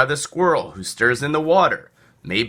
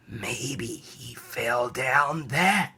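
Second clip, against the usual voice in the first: wailing; 7 dB quieter, much slower than usual. Acted. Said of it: "wailed the cat".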